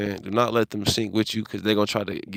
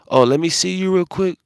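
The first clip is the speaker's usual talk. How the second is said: higher, louder mock sweet-talk from the laundromat woman; pushy stranger; 8 semitones higher, 5 dB louder than usual. Retelling the woman asking him to show his face, higher and louder fake-friendly voice. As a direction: ham up a fake-friendly ask. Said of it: "She was like".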